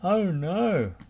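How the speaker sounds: high, loud cry of dismay. Acted. Lofty crying out as the tyre bounces back. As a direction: cry out as it goes wrong. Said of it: "cried Lofty".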